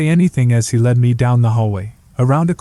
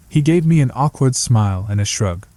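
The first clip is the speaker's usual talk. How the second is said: plain reading voice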